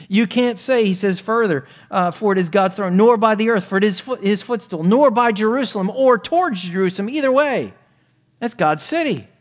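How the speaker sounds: impassioned pulpit delivery; full-force preaching. Acted. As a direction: press the point on the congregation. Preacher raises voice.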